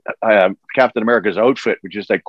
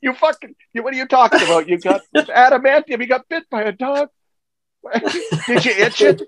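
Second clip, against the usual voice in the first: laughing disbelief; 13 semitones higher than usual. Retold or imitated. Retold disbelief while laughing.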